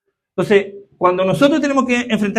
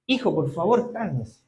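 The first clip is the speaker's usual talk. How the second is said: a parent soothing a son, ever softer; calming a child; 6 dB quieter than usual. Retold or imitated. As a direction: settle a child down, softer each phrase. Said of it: "Lo que una vez se le dice al hijo".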